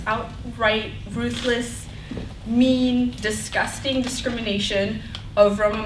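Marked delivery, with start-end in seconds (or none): none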